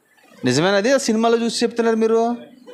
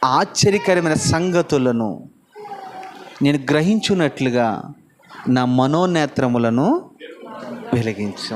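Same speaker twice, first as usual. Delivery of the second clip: prayerful plea; 6 semitones lower, slower than usual. Retold or imitated. David's prayer quoted slowly.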